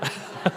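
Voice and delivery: a quick real chuckle at himself; short embarrassed laugh. Real, not acted. Speaker laughing at his teenage photo, brief chuckle. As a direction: laugh it off lightly.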